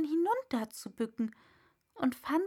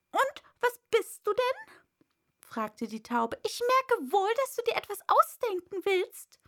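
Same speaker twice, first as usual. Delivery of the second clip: high, loud bird voice, doubting; suspicious pigeon; 10 semitones higher, 5 dB louder than usual. A narrator's reading. Pigeon questioning Alice, high and loud. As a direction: grill her, shrill bird.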